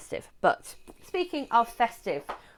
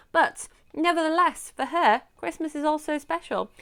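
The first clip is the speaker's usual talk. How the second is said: lively, higher blurb reading; 4 semitones higher than usual. Acted. Reading the blurb to viewers, lively and higher. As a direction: read the back cover with flair.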